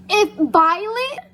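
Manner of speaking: loud, high gasp; loud shocked gasp. Real, not acted. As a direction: break off in a gasp. Shocked reading reaction.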